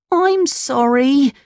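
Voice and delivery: very high, slow, sorry little voice; mild tearful apology. Acted. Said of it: "said poor Pinocchio".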